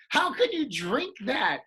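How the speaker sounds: high, loud, disbelieving question; incredulous. Retold or imitated. Scolding a friend for hot tea, high incredulous demand. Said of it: "And I go".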